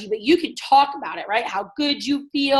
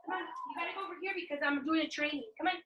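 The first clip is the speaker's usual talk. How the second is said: hushed aside; 4 semitones higher, 16 dB quieter, faster than usual. Real, not acted. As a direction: rush it under your breath. Hushing child mid-training.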